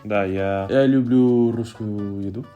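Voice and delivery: ordinary talk, nothing marked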